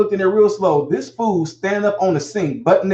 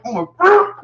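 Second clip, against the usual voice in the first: exasperated; 11 semitones higher, 4 dB louder than usual. Retold or imitated. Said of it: "it makes me like".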